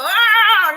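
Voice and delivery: a sudden loud, high exasperated "ah". Retold or imitated. Someone runs into that one person and goes, exasperated "ah". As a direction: blurt it out, fed up.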